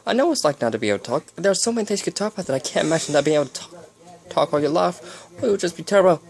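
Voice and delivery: high, fast, horrified babble; overexcited high-voiced chatter; exclaiming. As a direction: rattle it off in distress, pitched high.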